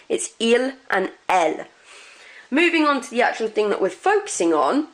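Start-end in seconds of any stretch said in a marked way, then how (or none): 0.4-0.7 "il" ending cleanly on L; "il" with no plural S heard; silent final S. Shown as a demonstration. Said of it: "you don't pronounce them". 1.3-1.7 "elle" ending cleanly on L; "elle" with no plural S heard; silent final S. Shown as a demonstration. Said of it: "you don't pronounce them".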